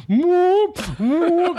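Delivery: long, high whooping whale call. Shown as a demonstration. Co-host jokingly playing a whale from Antarctica, whale whoop. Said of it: "It's, it's a whale".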